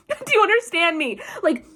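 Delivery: ordinary talk, nothing marked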